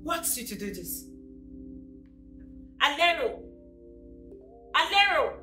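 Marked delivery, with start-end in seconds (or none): 2.8-5.3 loud, high summoning shout; calling out. Acted. Angry parent summoning the helper, shouted call. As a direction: holler the name across the house.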